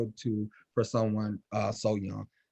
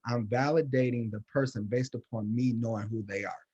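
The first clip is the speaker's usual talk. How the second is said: normal talking voice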